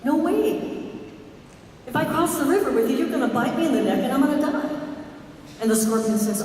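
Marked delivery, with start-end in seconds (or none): 0.1-4.7 higher, fast, alarmed refusal; alarmed turtle. Acted. Turtle refusing the scorpion, higher and fast. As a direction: turtle backing away from the scorpion's request. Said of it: "The turtle says".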